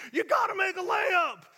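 full-force high coach's yell; high, drawn-out bellow; screaming coach. Retold or imitated. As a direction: scream it across the court. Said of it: "this one coach was screaming".

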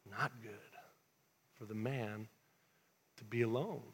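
very soft, low, with long pauses; grave verdict. Acted. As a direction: let each phrase fall quietly and low. God's verdict on man alone.

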